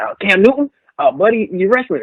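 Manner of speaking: a hint of the older trainer, casual and teasing; imitating George.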